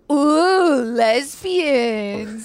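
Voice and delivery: high, loud, drawn-out "ooh" of mock scandal; loud, stretched-out leering tease; teasing dad. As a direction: imitate a dad hooting at the TV. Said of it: "my dad would be like ... make noises".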